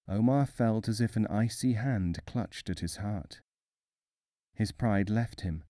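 plain reading voice

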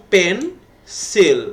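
"Pencil" in two separate beats, pen, sil; split into syllables. Shown as a demonstration. Teacher dividing "pencil" for pupils, split into two syllables. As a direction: say "pencil" slowly with a gap before the second syllable. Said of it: "আমরা ভাগ করি".

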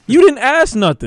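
high, loud exasperated call-out; loud protest. Real, not acted. Viewer yelling at a courtroom show, loud exasperation. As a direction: yell at the screen.

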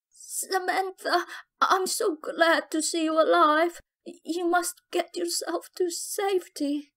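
dying mother, high and strained; gasping in agony. Acted. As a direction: gasp out the words in pain. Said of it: "Junima spoke in agony while gasping".

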